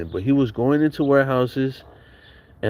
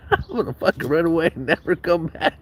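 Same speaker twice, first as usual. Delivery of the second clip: higher, whiny put-on kid voice, half laughing; mock crying kid; 7 semitones higher than usual. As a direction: ham up a sulking kid threatening to run away. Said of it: "I was, like, crying in my room".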